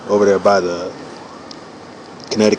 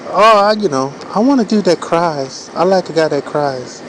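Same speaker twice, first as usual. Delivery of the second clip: imitating a woman; 8 semitones higher than usual. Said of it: "they'll be like".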